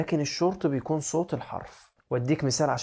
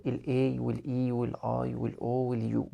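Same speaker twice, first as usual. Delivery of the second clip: name each letter carefully, one by one; slowly listing letters; slower than usual. Shown as a demonstration. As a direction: spell each vowel out slowly. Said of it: "خمس حروف متحركة".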